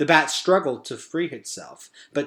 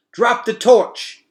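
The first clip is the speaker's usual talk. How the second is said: slow, high, loud yelled order; 11 semitones higher, 10 dB louder, slower than usual. A narrator's reading. Merith ordering Gregor to drop the torch, loud yell. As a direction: shout the command to the boy. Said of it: "yelled Merith".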